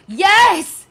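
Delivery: high, loud, thrilled squeals of yes; excited outburst. Real, not acted. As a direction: burst out high with real delight. Thrilled reaction to viewer's choice.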